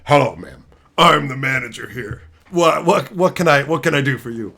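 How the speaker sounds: low, loud pompous manager voice; fake manager. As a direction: pose as the manager, puffed up. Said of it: "I'd be like".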